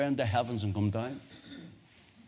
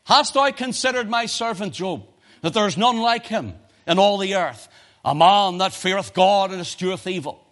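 higher and louder, proud and booming; voice of the Lord; 6 semitones higher, 7 dB louder than usual. Acted. The Lord boasting to Satan of Job, booming. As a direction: proclaim it like God boasting of Job. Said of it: "And the Lord says".

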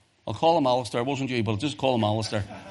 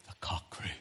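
hushed reading; 9 semitones lower, 18 dB quieter than usual. Acted. Hushed climax of the scripture reading.

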